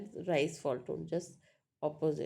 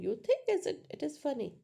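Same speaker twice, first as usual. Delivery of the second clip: much higher pitch on 'You think', still high on 'funny'; asking for confirmation; 8 semitones higher, much faster than usual. Shown as a demonstration. Teacher demonstrating a confirmation question, pitched high from 'You think'. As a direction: query it with a high, surprised-sounding lift on 'think'. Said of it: "asking for, uh, confirmation".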